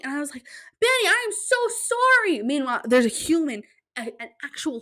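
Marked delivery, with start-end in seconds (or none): 0.8-2.4 much higher, strained sorry; squeaky apology. Retold or imitated. Apologizing with girl on back.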